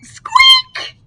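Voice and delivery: sharp high squeak. Acted. The noise from the roof, sharp high squeak.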